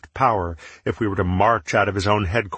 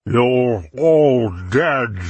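slow, louder threat; menacing villain; 5 semitones higher, 5 dB louder, much slower than usual. Acted. Menot threatens, mouth full.

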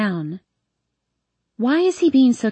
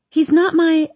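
flustered protest; 8 semitones higher, 5 dB louder than usual. A narrator's reading. Protesting, cut off.

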